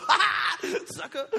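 high, loud hammed-up cackle then a jeer; mock gloating laugh. Preacher playing a swindler taunting his lender, mock laughing. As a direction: laugh in his face like a con man. Mocking the friend who lent money.

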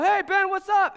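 high, loud, playful hello; excited dad greeting. Retold or imitated. Dad home from work greeting his baby, excited. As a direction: call out brightly to a baby.

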